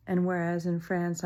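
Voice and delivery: usual speaking voice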